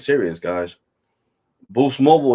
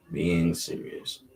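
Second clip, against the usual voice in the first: "Being serious" dragged out slowly, word by word; slow repeat; 4 semitones lower, 6 dB quieter, much slower than usual. Shown as a demonstration. Telling his viewers he means it, slowly and deliberately. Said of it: "And I'm being serious".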